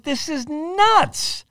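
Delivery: very high, very slow, drawn-out "nuts" that falls; hammed-up astonishment; amazed disbelief. Repeats the stunned reaction, bigger.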